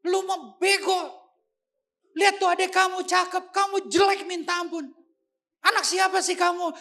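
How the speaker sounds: father berating his son, shouting high and loud; scornful father. Retold or imitated. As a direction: belittle him, comparing him to his brother. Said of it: "Dia bilang".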